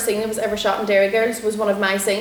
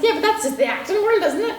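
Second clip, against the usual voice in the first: high, put-on voice for a breezy answer; mock reply; 11 semitones higher than usual. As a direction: toss it off in a much higher comic voice. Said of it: "I was like".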